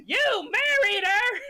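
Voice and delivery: high, slow, loud wailing yell; hammed-up shout. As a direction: bellow the punchline at him. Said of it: "and he goes".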